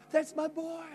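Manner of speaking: ordinary delivery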